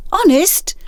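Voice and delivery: quick high afterthought; hasty add-on. Acted. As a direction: tack it on fast. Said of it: "adding a hasty".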